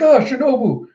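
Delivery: high-pitched call of distress; retold panicked shout; mock alarmed cry. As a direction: call her name in acted alarm. Cries out as she is grabbed.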